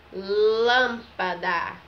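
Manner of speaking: LÂMPADA very slow and loud, long nasal first syllable; closed Â sound. Shown as a demonstration. Teacher presenting the next circumflex word, slow loud LÂMPADA with closed Â. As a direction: stretch and stress the closed "lâm" of LÂMPADA. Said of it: "tem um som mais".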